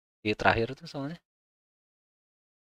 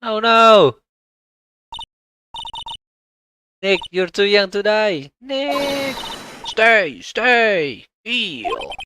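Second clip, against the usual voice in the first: higher, louder, slow desperate pleading; mock panic; 8 semitones higher, 5 dB louder, much slower than usual. Maya crying over a mauled Phoenix, hammed-up panic. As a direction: beg him not to die, overplayed.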